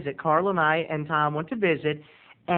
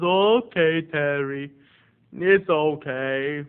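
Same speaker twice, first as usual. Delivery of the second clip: slow, stretched, grudging acceptance; drawn-out forgiving reply; imitated eccentric aunt; much slower than usual. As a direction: let it go in the odd aunt voice. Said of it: "she talks like this".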